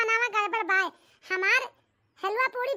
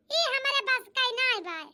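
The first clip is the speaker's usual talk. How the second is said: ordinary talk, nothing marked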